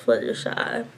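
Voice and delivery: breathy near-whisper, a little embarrassed; shy whispered admission; whispered name. Real, not acted. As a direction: whisper the name like a secret confession. Confessing her favourite pony.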